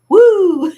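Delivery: high, very loud "woo"; playful loud cheer; showy whoop. Acted. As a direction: whoop like a magician after a trick. Cheers her own trick.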